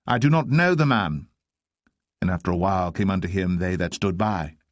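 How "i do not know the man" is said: high, emphatic denial; sworn denial. Acted. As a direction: swear it, pitch jumping up. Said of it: "he denied with an oath".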